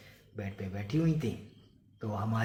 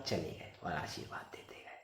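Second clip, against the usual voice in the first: whispered ending; 6 semitones lower, 13 dB quieter than usual. Acted. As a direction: whisper the close of the tale. Whispered end of the tale.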